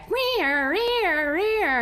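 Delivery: high, drawn-out siren wail; imitated siren, high and swooping; wailing siren imitation. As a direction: wail like approaching sirens, each one rising and falling. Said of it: "we heard sirens blaring".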